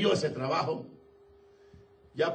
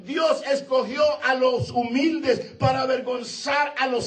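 impassioned shouted preaching, higher and louder; preaching at full force; 10 semitones higher, 6 dB louder than usual. Acted. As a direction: preach it out to the congregation. (predicación a todo pulmón)

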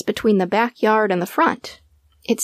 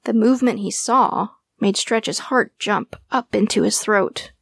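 ordinary delivery

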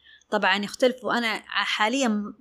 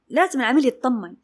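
ordinary delivery

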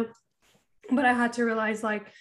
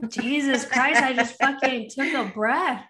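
mock-exasperated, louder and drawn out; exasperated retort; 3 semitones higher, 4 dB louder, slower than usual. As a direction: imitate a fed-up husband protesting. Retold exasperated husband in a spat.

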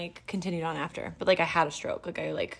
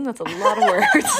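laughing; 6 semitones higher, 8 dB louder, slower than usual. Real, not acted. Joking about skimming headlines.

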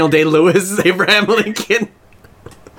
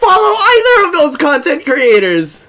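mock whiny cry; 13 semitones higher, 7 dB louder than usual. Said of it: "mock crying protest".

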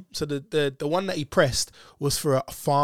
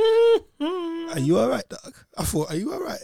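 mocking; 13 semitones higher, slower than usual. Mocking the old officer.

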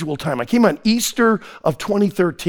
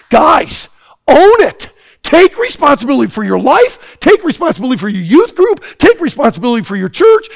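loud, pressing exhortation at a higher pitch; preaching at full force; 5 semitones higher, 5 dB louder than usual. Acted. Preacher urging the youth to own it, loud and pressing. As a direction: drive each command home to the crowd.

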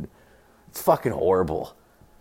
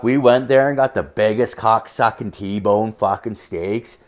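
ordinary talk

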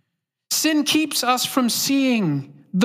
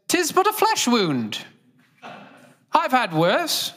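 put-on British accent; 4 semitones higher than usual. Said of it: "It's even better in a British accent".

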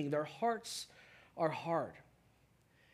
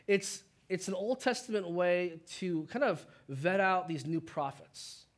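ordinary delivery

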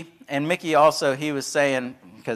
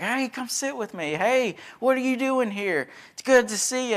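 higher, bright friendly greeting voice; imitating residents calling you over happily; eager welcome; 10 semitones higher than usual. Residents greeting the visiting team, higher and eager. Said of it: "They're like".

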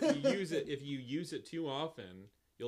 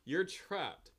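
usual speaking voice

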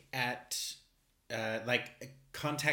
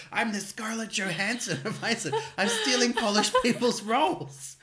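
much higher and louder, laughing through a mock confession; laughing mock boast; 14 semitones higher, 11 dB louder than usual. Pretending to confess stealing roles, high laughing gloat. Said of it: "why don't you just go".

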